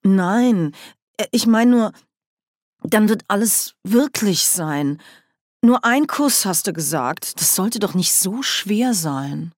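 a panicking duke, lightly voiced; panicked. A narrator's reading. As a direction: backpedal in sudden panic. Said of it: "Panik erfasste den Herzog".

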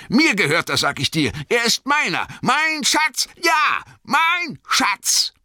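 high, loud, obsessive claim; Bilbo, besitzergreifend. A narrator's reading. Bilbo quarrels over the ring. (besitzergreifend und zornig, schrill hoch)

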